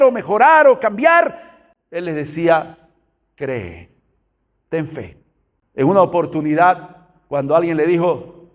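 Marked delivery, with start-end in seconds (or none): 3.3-5.2 low, soft and calm; aliento sereno. Acted. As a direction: reassure gently, much quieter and lower. Jesús alienta al que duda. (con voz baja y serena)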